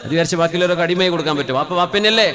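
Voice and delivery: preaching at full force. Acted. Preacher to the congregation on loving homes, loud sung preaching. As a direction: pour it out like a fervent preacher, drawing out the Arabic. Long fervent preaching run.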